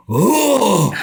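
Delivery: high, loud disgusted oh; repulsed groan. Retold or imitated. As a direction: react grossed out.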